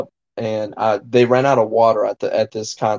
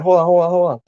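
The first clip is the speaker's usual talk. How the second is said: mock hurried call; 6 semitones higher, 4 dB louder, faster than usual. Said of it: "He's like".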